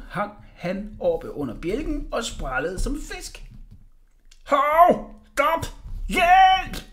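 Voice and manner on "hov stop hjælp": high, loud panicked cries; screaming for help. A narrator's reading. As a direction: scream as if hoisted off the ground. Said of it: "skreg han".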